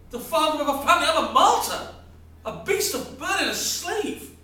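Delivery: husband explodes at his friend about his wretched life; furious rant. Acted. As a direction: throw every grievance at him. Man rants about his martyrdom.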